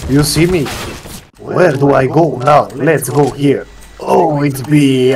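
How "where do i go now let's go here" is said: usual speaking voice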